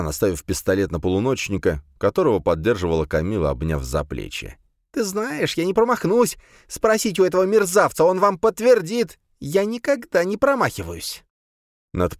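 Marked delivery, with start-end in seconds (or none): 4.9-11.2 high, tense threatening voice; threatening woman. A narrator's reading. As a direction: threaten them, voice pitched high. Sabrina threatens the police.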